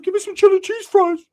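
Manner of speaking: high, loud squeaky food order; mock eager cousin.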